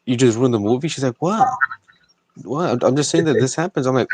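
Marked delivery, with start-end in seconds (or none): 0.0-0.9 high, loud disbelief; incredulous, raising the voice; exasperated outburst. Retold or imitated. Retelling a quarrel with mom. 1.2-3.8 high-pitched, defensive protest; defensive mom. Retold or imitated. Mom's defensive reply, acted.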